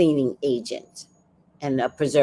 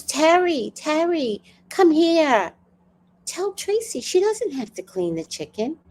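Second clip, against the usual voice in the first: calling out; 10 semitones higher than usual. Retold or imitated. Mom calling sister over.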